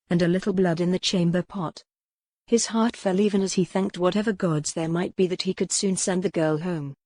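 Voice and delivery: plain reading voice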